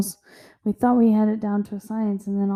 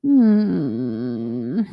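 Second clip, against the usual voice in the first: imitating sleepy cat hum; 9 semitones lower than usual. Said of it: "he'll just".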